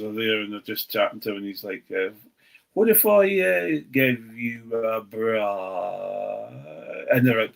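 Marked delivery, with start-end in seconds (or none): 2.7-6.5 mock South African driver, slow on give you a bra; imitating a South African accent, drawn out. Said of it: "the South African accent".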